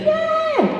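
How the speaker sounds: "Yeah!" pitched very high and falling; high British yeah. Shown as a demonstration. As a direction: demo the sky-high polite "Yeah!". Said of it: "when our voices go up like that and we say".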